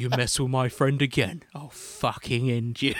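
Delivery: a hint of a gangster heavy, half laughing; Vinnie Jones threat. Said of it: "just like".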